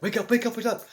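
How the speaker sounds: high, loud wake-up yell; panicked wake-up shout; urgent shout. Retold or imitated. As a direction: snap yourself awake. Waking at the wheel.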